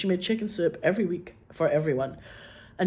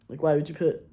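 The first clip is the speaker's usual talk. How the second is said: ordinary talk, nothing marked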